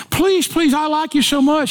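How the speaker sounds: high, pleading please please; begging boyfriend. Retold or imitated. Boyfriend at the door begging his date for a goodnight kiss, high pleading voice. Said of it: "I begged".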